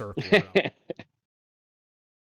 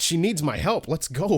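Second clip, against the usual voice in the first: mock eager; 5 semitones higher, 6 dB louder than usual. Voicing himself eager.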